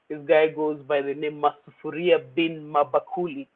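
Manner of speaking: ordinary talk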